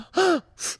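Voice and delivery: high, loud shocked intake of breath; sharp offended gasp. Retold or imitated. The pregnant woman reacting to the touch, a loud high gasp. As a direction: gasp as if insulted. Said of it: "and she goes".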